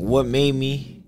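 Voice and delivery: ordinary talk, nothing marked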